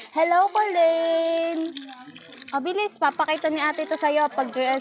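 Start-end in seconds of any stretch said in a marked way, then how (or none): 0.1-1.7 put-on slow prompt for a child. Shown as a demonstration.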